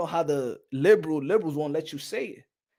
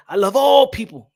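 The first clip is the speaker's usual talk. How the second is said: loud, raised-pitch proclamation; proud declaration; 6 semitones higher, 11 dB louder than usual. Acted. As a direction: belt it out with a big ALL.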